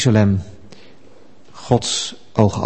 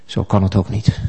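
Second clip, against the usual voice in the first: low, dejected mumble; resigned mumble; 5 semitones lower, 7 dB quieter than usual. Acted. Dejected petitioner shuffling off to the door, mumbling. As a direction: mumble it quietly and give up. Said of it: "mompelt nog wat en gaat weg".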